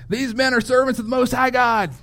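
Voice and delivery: high-pitched mock yell; yelling slave girl. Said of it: "this slave girl start yelling".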